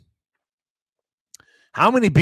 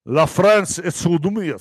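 slow, careful French accent; amateur French accent, lightly put on; slower than usual. Shown as a demonstration. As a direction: try out a French accent on the phrase. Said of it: "My French accent".

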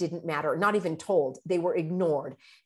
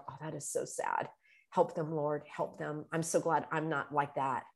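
much softer, inward murmur; said to myself; 4 semitones lower, 8 dB quieter than usual. Shown as a demonstration. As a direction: murmur it to yourself. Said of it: "say to myself".

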